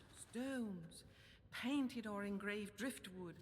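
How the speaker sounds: normal talking voice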